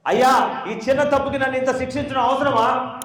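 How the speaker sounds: high-pitched tearful plea, a touch of mock sobbing; pleading cry. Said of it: "అప్పుడు ఇంకా ఏడవటం మొదలు పెట్టాడు". (ఏడుస్తూ వేడుకోలు)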